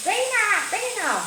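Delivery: lightly imitating a high Turkish-accented call; mock the ex calling her name in a Turkish accent, high. Ex-boyfriend outside the hostel calling her, light mock Turkish accent. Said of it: "in his Turkish accent".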